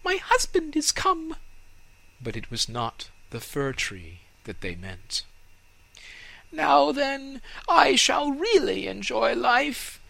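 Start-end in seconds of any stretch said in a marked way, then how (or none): 0.0-1.4 very high, loud bird call; chirping swallow. Acted. 6.5-10.0 high, loud, exultant tree voice. Acted. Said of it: "he said exultingly".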